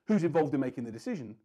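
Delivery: quick, matter-of-fact fire-off; rushed blunt question. Shown as a demonstration. Salesman firing a rote question at the prospect, rushed. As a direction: rattle it off like a checklist item. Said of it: "I don't want to answer that question because the way it was asked".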